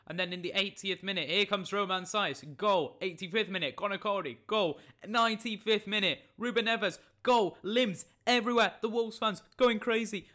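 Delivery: high, loud, fast-building match commentary; punchy play-by-play with shouted goals; excited goal commentary. Acted. Re-enacts late goals as commentary.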